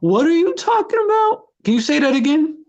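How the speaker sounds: high-pitched, exaggerated incomprehension; hella confused. Showing a friend how to frustrate them, hella confused. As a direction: play dumb, as if nothing they say makes sense. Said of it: "hella confused, like, you don't, literally don't understand".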